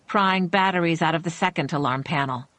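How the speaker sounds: plain reading voice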